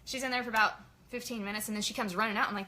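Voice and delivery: normal talking voice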